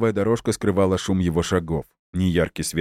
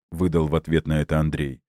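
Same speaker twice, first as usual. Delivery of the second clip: ordinary delivery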